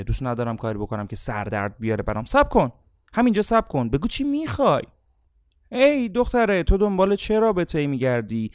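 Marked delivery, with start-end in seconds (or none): 2.2-4.8 high, loud commanding stop; sharp stop. Acted. Coach stops the speaker sharply. 5.7-8.5 put-on high, loud probing question to a girl; a hint of a pushy interviewer; lightly voiced questioner. Coach questions an imagined girl.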